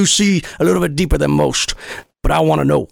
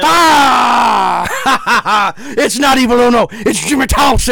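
laughing triumphant shout; 5 semitones higher, much slower than usual. Real, not acted. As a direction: crow the reveal through laughter. Prank reveal, laughing.

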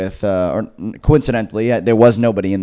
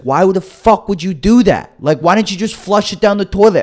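higher, exasperated father scolding; mock angry dad; 9 semitones higher, 3 dB louder than usual. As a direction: imitate dad losing his patience.

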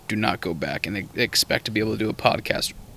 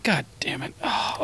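whispered; 3 semitones higher, 6 dB quieter, slower than usual. Real, not acted. Disappointed by short time left.

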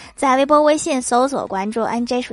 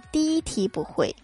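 soft, unbothered reply; calm; 4 semitones higher, 7 dB quieter than usual. Acted. Top student calmly telling classmates he failed the first question. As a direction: shrug it off coolly. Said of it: "学霸淡定地回答道".

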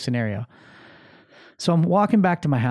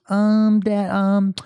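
put-on high, loud little-girl stalling; mimicked child hesitating; gum-smacking daughter; 7 semitones higher, 5 dB louder, much slower than usual. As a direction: stall like a kid caught out. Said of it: "smacking her gum".

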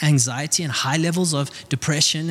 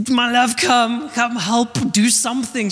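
high, strained mock scream for help; retold scream; 7 semitones higher than usual. Husband screaming to his wife for help with the flood, mock yell. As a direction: call out in a raised, panicky mock yell. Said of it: "I'm just screaming at Jenna".

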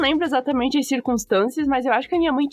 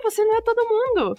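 mock mom reply; 9 semitones higher than usual. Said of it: "E daí".

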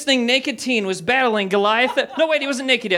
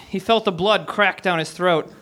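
usual speaking voice

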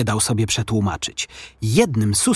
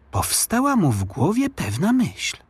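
plain reading voice

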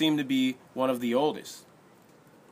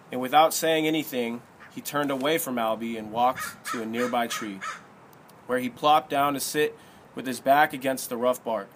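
usual speaking voice

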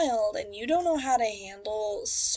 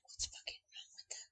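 judgy neighbor; 20 dB quieter than usual. Retold or imitated. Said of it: "neighbors are gonna be like".

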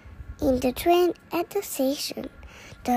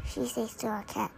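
plain reading voice

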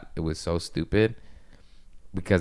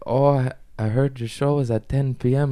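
lightly imitated German accent, pitched higher; 6 semitones higher than usual. Comedian imitating the DJ he opened for, slight German accent. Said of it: "he has a slight German accent".

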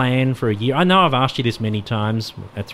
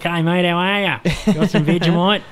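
mock broad Aussie accent, higher pitched; thick Australian accent; 7 semitones higher, 3 dB louder than usual. Joking host shows a guest what too thick sounds like, exaggerated Australian accent. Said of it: "That'd be really thick".

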